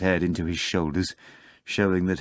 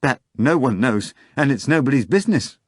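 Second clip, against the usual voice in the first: higher, louder, fast indignant retort; offended protest, sped up; 6 semitones higher, 6 dB louder than usual. Acted. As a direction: bristle and snap back quickly. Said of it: "grew indignant".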